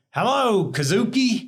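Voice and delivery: high, hearty mock-Texan greeting; good old Texan. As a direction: call it out big like a welcoming Texan. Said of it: "good old Texan".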